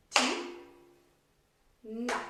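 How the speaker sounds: "Ti-na" split by a long pause, each syllable punched; "Ti", long gap, then "na". Shown as a demonstration. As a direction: clap out "Ti-na", one syllable per beat. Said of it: "chercher le nombre de syllabes".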